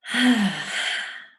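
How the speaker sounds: loud, falling exhale; big releasing sigh. Real, not acted. Guide finishes meditating with the group, releasing sigh. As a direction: let it all out in one big sigh.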